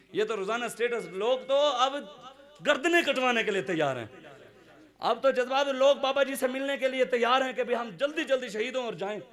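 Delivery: high, fervent sermon voice; impassioned preaching. Real, not acted. Preacher urging the crowd toward the march, impassioned preaching.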